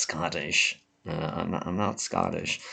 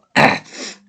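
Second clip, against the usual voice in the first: loud chesty cough; a harsh real cough breaking the talk; 14 dB louder than usual. Real, not acted. Vlogger with a head cold, coughing hard.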